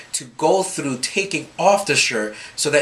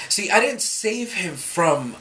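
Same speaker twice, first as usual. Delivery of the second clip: normal talking voice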